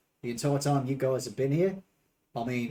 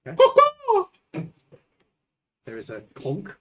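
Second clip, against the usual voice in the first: normal talking voice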